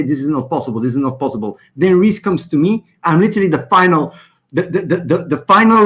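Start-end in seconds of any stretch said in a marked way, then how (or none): 0.0-1.6 lower, softer mock of a droning lawyer. Mocking the lawyers who only say no, low and soft. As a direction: mumble it like a useless lawyer. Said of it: "the only thing they say".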